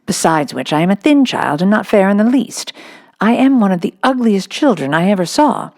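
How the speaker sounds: put-on a little girl wondering to herself; young girl's earnest inner voice; Sarah.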